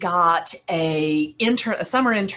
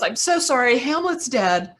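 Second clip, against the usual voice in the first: distressed apology; 6 semitones higher than usual. Retold or imitated. Intern confesses to the boss.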